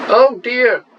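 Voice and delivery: high, loud dismayed oh dear; a sudden yelp of dismay. Real, not acted. Player watching his bet lose, yelping in dismay. Losing hands at the table.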